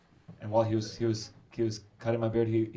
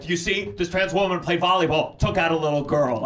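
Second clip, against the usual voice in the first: indignant friend; 5 semitones higher, 6 dB louder than usual. Retold or imitated. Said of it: "my transphobic friends are like".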